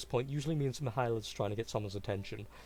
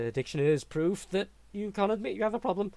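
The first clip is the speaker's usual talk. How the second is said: higher, louder manic protest; excited insistence; 10 semitones higher, 7 dB louder than usual. Acted. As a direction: argue it with wild caffeine energy. Lucius defending caffeine habit.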